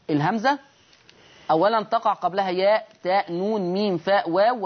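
usual speaking voice

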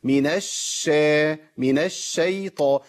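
من الشيطان with a long, spread sh; slow الشيطان, hissing sh held out; spreading doubled sheen. Shown as a demonstration. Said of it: "وبين التفشي فيها".

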